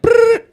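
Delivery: high, loud trilled ringtone; imitated phone ring. Man drunk dialing his friend at night, trilled ring. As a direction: roll out a "brrr" like a ringing phone.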